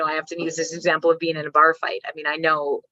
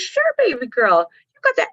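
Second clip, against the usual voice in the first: doting grandma; 6 semitones higher, 5 dB louder than usual. Retold or imitated. Mom imitating grandma answering the toddler, sweet and high. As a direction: coo it like a proud grandmother.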